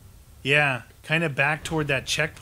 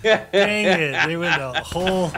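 laughing, louder and higher; 4 semitones higher, 9 dB louder than usual. Real, not acted. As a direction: laugh it out, annoyed. Laughing at the missed shot.

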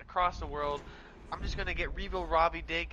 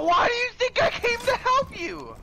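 exasperated yelling; 7 dB louder, slower than usual. Real, not acted. Arguing with teammate.

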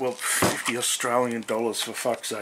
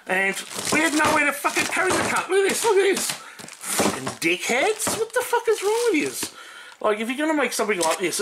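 angry rant; 12 semitones higher than usual. Real, not acted. Ranting at the hardware store design.

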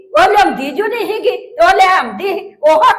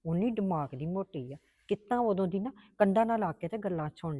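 low, much softer grumbling to herself; muttered aside; 10 semitones lower, 15 dB quieter than usual. Acted. Mother-in-law grumbling to herself about the daughter-in-law, soft muttered aside. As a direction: mutter it under your breath so she can't hear.

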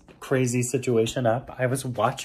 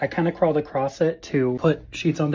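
ordinary talk

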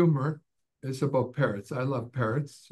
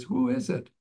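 high squawky parrot, pitched way up; 11 semitones higher than usual. Acted. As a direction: play the parrot calling through the door. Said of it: "the parrot says".

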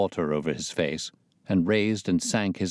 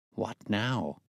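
softer, hushed question; whispered; 2 semitones higher, 6 dB quieter than usual. A narrator's reading. Holmes to Watson once Pinner leaves, hushed. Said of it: "whispered Holmes".

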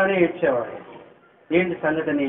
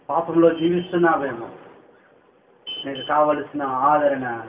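ordinary talk

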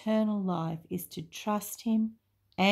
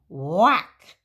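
loud whack; 9 dB louder than usual. Acted. Sound effect in story.

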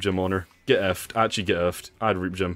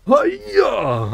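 high, loud karate-style yell; battle cry; 7 dB louder than usual. Pretend. Player strikes as he steals a chest, battle cry. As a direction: punch out a high-pitched attack shout.

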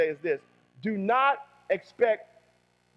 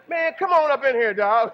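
higher and louder, calling someone in; loud welcome call; 4 semitones higher, 7 dB louder than usual. Pretend. Preacher calling a hat-wearer in, loud and welcoming. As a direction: shout a welcome across the room.

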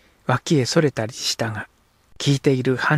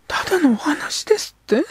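put-on high, hurt girl's voice; a girl stung by his words; young girl. Girl character speaks.

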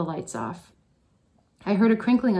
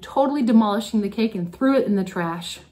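ordinary delivery

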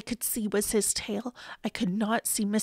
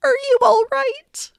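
loud, high anxious shout building higher; yelled; 11 semitones higher, 8 dB louder than usual. A narrator's reading. Boy shouts to Mister Carp underwater, anxious yell. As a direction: shout down to check on someone. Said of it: "I yelled".

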